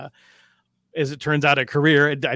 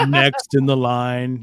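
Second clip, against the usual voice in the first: slow bored DMV clerk drone; flat weary sighing clerk; 6 dB louder, much slower than usual. Retold or imitated. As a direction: drag it out like a tired clerk. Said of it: "the worst impression of a D M V clerk".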